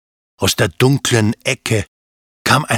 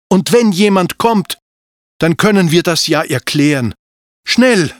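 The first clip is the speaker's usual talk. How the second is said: put-on higher young dragon boy pressing to hurry; Anton urging; 8 semitones higher, 4 dB louder than usual. A narrator's reading. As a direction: hurry her along, ending on an urgent "Schnell".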